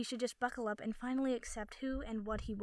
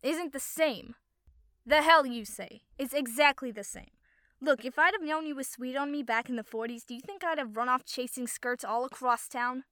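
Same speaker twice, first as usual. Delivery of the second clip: loud arguing; 2 semitones higher, 9 dB louder than usual. A narrator's reading. New Yorker arguing with his partner while walking past, loud heated arguing. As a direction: argue back hard at your partner. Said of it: "arguing loudly".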